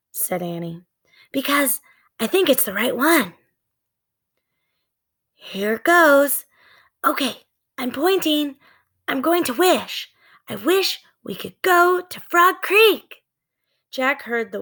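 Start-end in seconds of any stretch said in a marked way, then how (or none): 1.3-13.2 put-on little girl wishing. Annie wishing to go home to Frog Creek, eager and high. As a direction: make the wish with eager resolve.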